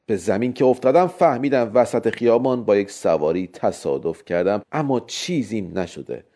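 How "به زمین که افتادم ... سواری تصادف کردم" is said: plain reading voice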